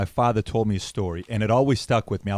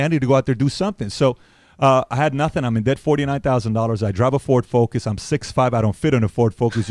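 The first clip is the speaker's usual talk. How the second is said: ordinary talk, nothing marked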